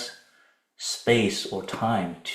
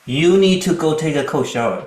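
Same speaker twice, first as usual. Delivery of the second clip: loud, high angry command; angry retort; 7 semitones higher, 9 dB louder than usual. Shown as a demonstration. Coach showing a retort that escalates a quarrel, angry. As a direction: fire it off heatedly.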